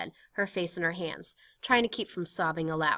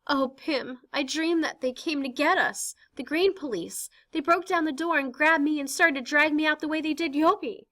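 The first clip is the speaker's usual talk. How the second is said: Anne shaken from a nightmare, higher; frightened teenage girl; 10 semitones higher, 4 dB louder than usual. Acted. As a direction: tell the dream still scared. Anne telling her nightmare.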